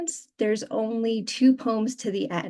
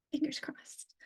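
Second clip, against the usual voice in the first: whispered little wish for luck; hushed aside; 6 semitones higher, 14 dB quieter than usual. Real, not acted. Poet to audience before starting her reading, whispered aside.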